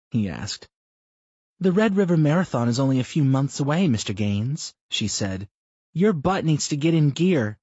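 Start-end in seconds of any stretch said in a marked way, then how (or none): 1.6-4.7 Brenda's voice. Acted. Wife teasing her husband mid-run, higher voiced. 5.9-7.6 Brenda's voice. Acted. Wife teasing her husband mid-run, higher voiced.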